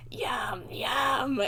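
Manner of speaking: whispered creature yum; breathy little gremlin whisper; imitating the girl gremlin.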